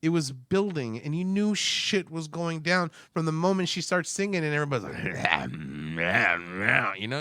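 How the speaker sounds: usual speaking voice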